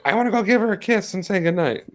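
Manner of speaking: put-on high-pitched little kid voice; imitated little son. Dad voicing his son asking to kiss his sister goodnight, little kid voice. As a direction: pitch it up like a small boy at bedtime. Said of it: "And my son's like".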